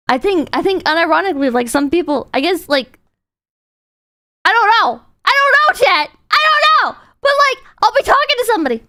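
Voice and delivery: usual speaking voice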